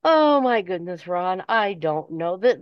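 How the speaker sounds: ordinary talk, nothing marked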